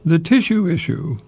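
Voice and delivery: "tissue" lifted high, rhyming with "issue"; tissue issue rhyme. Shown as a demonstration. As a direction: make "tissue issue" chime. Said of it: "those two words go together".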